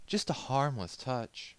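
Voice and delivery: high, slow and coy; coy lady's voice. Acted. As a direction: linger on it playfully. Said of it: "she said".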